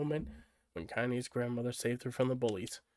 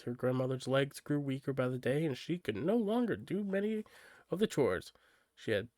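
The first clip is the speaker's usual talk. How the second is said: usual speaking voice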